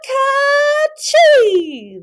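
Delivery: loud high sneeze; a kitten boy's spice sneeze; big sneeze. A narrator's reading. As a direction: let the sneeze explode. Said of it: "the kitty boy sneezed".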